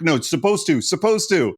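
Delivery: retold parental correction, mildly exasperated. Exasperated parents correcting kids' word choice.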